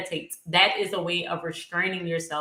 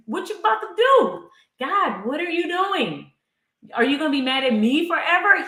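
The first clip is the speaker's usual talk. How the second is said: higher-pitched, louder mock outburst at God; lashing out; 7 semitones higher, 4 dB louder than usual. Psalmist demanding answers from God, lashing out. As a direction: imitate an indignant, exasperated complainer. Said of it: "He is lashing out".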